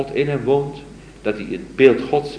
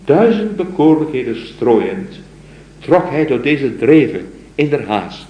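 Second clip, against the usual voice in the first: raised, slow solemn recitation; poem recital; 4 semitones higher, 6 dB louder, slower than usual. Acted. Lecturer reciting the mystic's poem to his students, solemn recital. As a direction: recite it like a devoted lover's plea.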